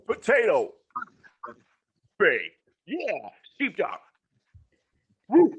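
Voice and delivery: audio cutting in and out; tape dropouts. Real, not acted. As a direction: sound keeps dropping out. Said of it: "your sound keeps cutting out on my side".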